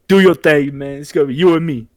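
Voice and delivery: high, loud hype; imitated cheering-on. Cena behind Cody cheering him on, high excited imitation.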